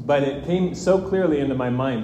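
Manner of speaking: ordinary talk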